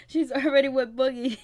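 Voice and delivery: laughing loudly through the words; can't get it out for laughing. Real, not acted. Vlogger cracking up over the gossip, laughing loudly.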